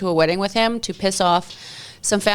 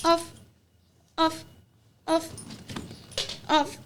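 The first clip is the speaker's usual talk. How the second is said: laughing at the dog; 10 semitones higher than usual. Real, not acted. Dog climbing on her, laughing.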